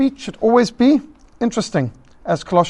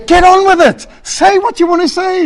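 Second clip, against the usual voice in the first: much higher and louder mock outburst at the caller; exasperated listener; 10 semitones higher, 8 dB louder than usual. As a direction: blurt out your impatience. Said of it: "you think".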